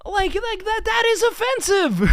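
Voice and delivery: high, loud exasperated ranting; incredulous rant. Real, not acted. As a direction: keep ranting in disbelief. Still ranting about remark.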